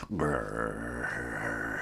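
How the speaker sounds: low growl; low doggy growl. A narrator's reading. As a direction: growl like a wary dog. Dog growling.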